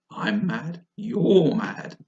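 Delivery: from firm to loud and high; building. Acted. The cat declaring Alice mad, building loud. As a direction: build to a gleeful cry.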